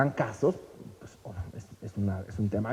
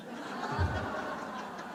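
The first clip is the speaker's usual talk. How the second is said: quiet breathy chuckle; soft laugh; 11 dB quieter than usual. Real, not acted. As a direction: chuckle at your own joke. Laughs at own punchline.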